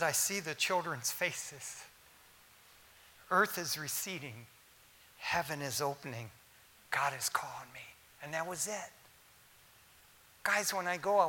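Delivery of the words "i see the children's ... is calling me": soft, spent delivery, fading to a near whisper; dying last words. Retold or imitated. Dying evangelist to his wife with his last breaths, fading softly. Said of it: "said this with the last couple breaths".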